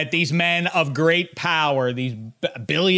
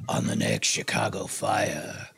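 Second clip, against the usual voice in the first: deep, slow movie-trailer voice; low announcer drawl for a TV promo; 9 semitones lower, 7 dB quieter, slower than usual. Retold or imitated. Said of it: "get somebody who's like".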